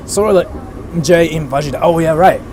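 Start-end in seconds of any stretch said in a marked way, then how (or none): none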